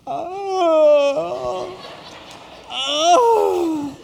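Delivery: high, loud strangled wail; breathless gasping wail. Retold or imitated. Preacher mimics himself trying to talk while not breathing, strangled wail. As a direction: try to talk with no air, a drawn-out high cry. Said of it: "it sounded something like this".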